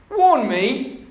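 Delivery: high-pitched, disbelieving questions; incredulous burglar. Acted. Burglar questioning a talking parrot, incredulous and high. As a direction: shoot up high in mock disbelief.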